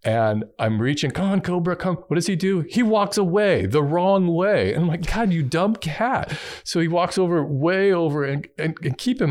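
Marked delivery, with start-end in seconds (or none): 1.1-1.9 higher-pitched retold call; coaxing a pet. Retold coaxing the cat through fence. 5.1-6.4 high, drawn-out mock exasperation; exasperated scolding. Retold scolding of the cat.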